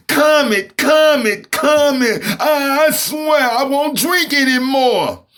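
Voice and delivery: put-on high, crying, pleading drunk voice; crying drunk Santa. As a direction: wail it like a sobbing Santa. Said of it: "He started crying and screaming".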